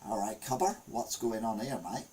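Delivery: a hint of a badly faked Australian accent. Going down under, in a badly faked Australian accent. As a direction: try an Aussie accent and miss. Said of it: "Why are you talking like that for?".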